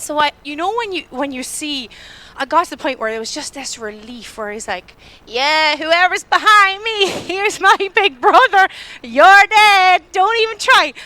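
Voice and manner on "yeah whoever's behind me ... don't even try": higher, louder, slow gloating; mock-tough taunt backed by big brother; triumphant taunt. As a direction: taunt the follower with relief. Relieved, boasting of big brother.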